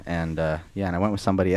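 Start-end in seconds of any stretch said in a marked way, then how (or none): none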